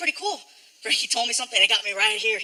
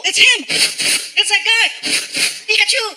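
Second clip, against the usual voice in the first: excited fans; 9 semitones higher, 3 dB louder, much slower than usual. Retold or imitated. Excited fans spotting him.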